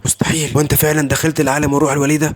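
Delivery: ordinary delivery, nothing marked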